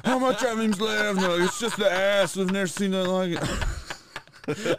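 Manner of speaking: hammed-up shocked mom and doctors; mocking the delivery. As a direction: play the stunned delivery staff. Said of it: "Your mom's like".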